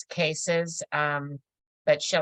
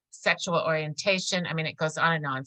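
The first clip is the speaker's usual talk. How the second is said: normal talking voice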